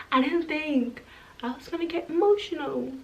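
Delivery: real emotion welling up, almost breaking; choked up. Real, not acted. Telling her story and tearing up, real choked-up voice. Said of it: "I'm not about to cry".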